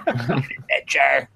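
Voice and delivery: slow raspy smoker's drawl; chain smoker voice. Retold or imitated. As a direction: croak it out like a heavy smoker. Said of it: "he would sound like a chain smoker".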